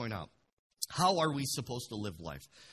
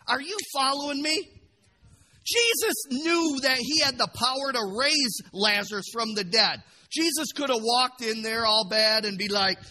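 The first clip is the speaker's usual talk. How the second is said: loud, high-pitched impassioned proclamation; full-force preaching; 12 semitones higher, 11 dB louder than usual. Real, not acted. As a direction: drive the point home at full voice.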